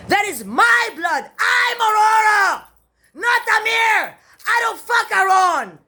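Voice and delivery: high, drawn-out angry declaration; indignant shouting. Real, not acted. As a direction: shout each claim slowly, insisting on it. Indignant outburst asserting who she is.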